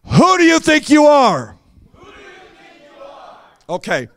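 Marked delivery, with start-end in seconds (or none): 0.1-1.6 higher, shouted, full conviction; really mean it. Shown as a demonstration. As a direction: say it like you truly mean it. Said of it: "say it like you really mean it".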